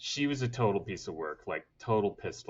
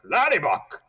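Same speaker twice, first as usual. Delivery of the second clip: "Laddie Buck" said higher and louder like dad; dad's voice; 8 semitones higher, 9 dB louder than usual. Retold or imitated. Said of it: "he'd call us Laddie Buck".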